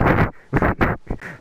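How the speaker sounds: breathy burst of laughter; real laugh. Real, not acted. Teller recalling his laughter on the floor, real laughing fit. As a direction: laugh helplessly at the memory.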